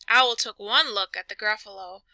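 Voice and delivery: plain reading voice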